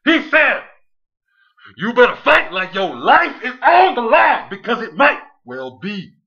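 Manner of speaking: full-force hammed-up promo delivery; over-the-top wrestling promo. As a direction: bellow it slowly like a hyped-up wrestler.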